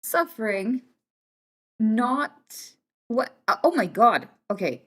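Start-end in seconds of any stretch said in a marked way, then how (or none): none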